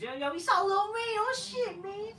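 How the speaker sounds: high, excited squeal of a starstruck fan; excited fanboy. Retold or imitated. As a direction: gush like meeting your hero. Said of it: "[name] runs into [name]".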